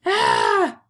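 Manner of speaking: sudden loud, high "aah"; loud exclaimed "ah". Acted. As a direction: let out a big, high "ah". Dog finally relieves his itch.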